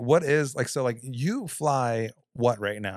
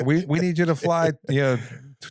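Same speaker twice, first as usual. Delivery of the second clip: higher, louder rapid controller orders; mock controller; 4 semitones higher, 5 dB louder than usual. Imitating air traffic controller.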